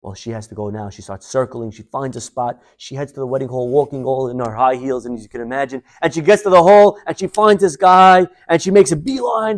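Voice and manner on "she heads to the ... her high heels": ordinary talk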